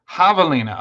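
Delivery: "Javelina" with the J said as H; H pronunciation. Shown as a demonstration. Trying the word after chat's answer, "Javelina" with an H. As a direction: say "Javelina" opening on "hah", as in Spanish. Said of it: "Okay, that makes more sense".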